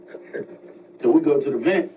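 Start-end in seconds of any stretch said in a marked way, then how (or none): 0.1-0.5 hushed call. Retold or imitated. Sneaking to the vent, quiet.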